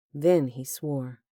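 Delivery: ordinary delivery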